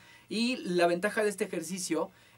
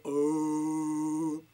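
bear voice; 3 dB louder than usual. Shown as a demonstration. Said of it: "en esta voz".

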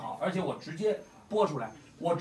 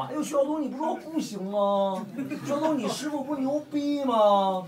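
high, slow sneering taunt; mocking Lei Lei; 8 semitones higher, 4 dB louder than usual. Streamer mimics rival mocking him, high sneer. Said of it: "王八蛋回来骂我".

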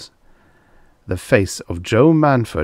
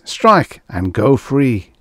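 slow, raised urging; commanding voice; 5 semitones higher, 5 dB louder, much slower than usual. A narrator's reading. Unseen voice tempts the girl to strike, slow and commanding. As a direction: draw out the order, loud and high. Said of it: "said the voice".

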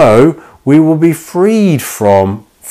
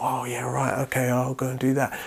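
very soft, hurried mock agreement; meek compliance; 13 dB quieter than usual. Person agreeing to sin's hurtful request, meekly compliant. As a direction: mutter a quick, obedient yes.

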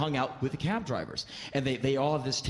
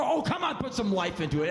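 much higher, urging come on; pepping up; 7 semitones higher than usual. Retold or imitated. Comic urges the bored driver to pose, high and pushy. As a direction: push him to perk up for the photo. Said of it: "At least point to the animal".

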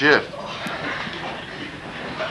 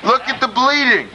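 raised, high-pitched excited call; excited commentary; 7 semitones higher than usual. Real, not acted. Commentator pointing out the bleeding wrestler, excited call.

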